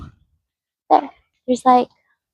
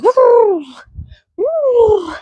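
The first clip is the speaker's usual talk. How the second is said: high hooting woo from a creaky swing; playful high imitation of a swing creaking; imitated swing noise; 10 semitones higher than usual. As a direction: mimic the swing's squeaky woo. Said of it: "making all sorts of noises".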